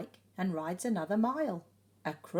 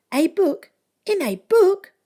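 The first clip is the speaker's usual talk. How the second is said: put-on high, loud, far-off boy voice; Gingerbread Boy voice; 10 semitones higher, 14 dB louder than usual. A narrator's reading. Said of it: "says the Gingerbread Boy".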